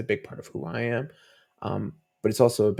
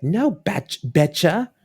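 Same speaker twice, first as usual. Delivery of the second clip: put-on higher, louder child's voice saying Betcha; little girl voice; 7 semitones higher, 7 dB louder, slower than usual. Daughter insisting on the dog's name, higher and louder. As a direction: play the small daughter insisting.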